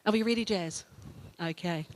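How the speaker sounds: ordinary talk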